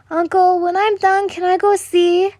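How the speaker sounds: put-on high, eager child voice; a small girl asking a favour; little girl voice.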